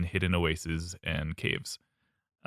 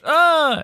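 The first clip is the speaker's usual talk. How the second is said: high squeal; 9 dB louder than usual. Pretend. Baboon screams and flees.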